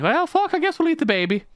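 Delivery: high-pitched comic resignation; mock-Irish reluctant agreement; resigned Irish villager. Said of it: "them being like".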